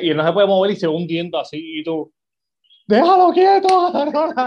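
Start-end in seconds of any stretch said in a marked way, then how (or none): 2.9-4.3 high, loud yell that breaks into a laugh; mock yell at the screen. Viewer yelling at the screen to leave him alone, high and loud. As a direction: shout a warning at the screen, laughing.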